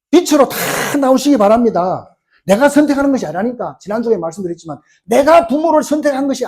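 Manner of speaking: high, loud exhortation; forceful preaching. Real, not acted. Preacher urging congregation to choose faith, forceful preaching.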